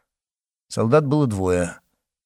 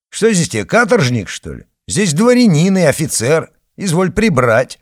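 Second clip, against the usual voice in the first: loud, high, angry scolding; 7 semitones higher, 6 dB louder than usual. Acted. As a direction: berate him from above. Officer berates the guard.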